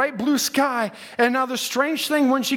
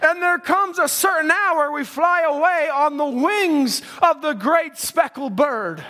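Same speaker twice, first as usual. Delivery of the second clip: full-force preaching; 6 semitones higher than usual. Acted. Preacher to his church about the eagle, full-force preaching. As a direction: preach it out, voice soaring.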